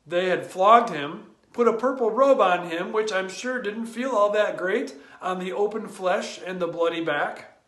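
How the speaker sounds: normal talking voice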